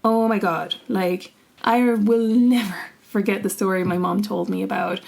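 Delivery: ordinary talk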